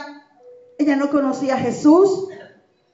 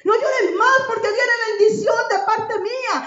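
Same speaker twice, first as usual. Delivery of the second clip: the Lord comforting with tears in the voice; tearful preaching as the Lord; 6 semitones higher than usual. Acted. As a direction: build each no llores más with emotion. Voicing the Lord, crescendo.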